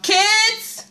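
high call across the house. Real, not acted. Calling kids about alarm.

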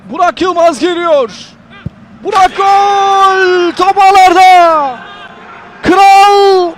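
loud, drawn-out goal scream; screaming football commentator. Real, not acted. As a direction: roar the goal and crown the king. Goal call in live commentary.

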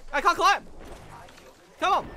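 loud, high exclamation of frustration; frustrated shout. Real, not acted. Player stuck on a climb, frustrated shout. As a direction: yell it in mid-fight panic.

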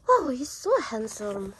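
ordinary talk

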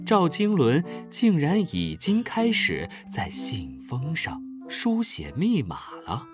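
usual speaking voice

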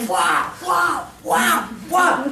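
loud high fighting grunts; mock brawl noises. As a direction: shout the punches. Niños pegándose. (niños pegándose a gritos)

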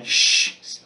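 sharp unvoiced shush; whispered shh; hushing shh. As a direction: hush the barking dog. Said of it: "said Jack".

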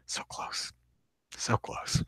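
breathy hushed so close; tense murmured near miss; whispered. Real, not acted. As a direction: whisper it under your breath.

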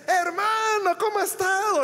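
bright, loud, high delighted hello; cheerful greeting. Retold or imitated. Church member greeting her pastor at the counter, high and delighted. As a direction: greet him like a happy surprise.

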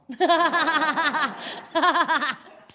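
big burst of laughter at own joke; hearty laughing fit; loud laugh. Real, not acted. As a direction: crack up loudly.

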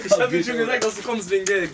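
high, still laughing. Real, not acted. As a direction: say it through laughter.

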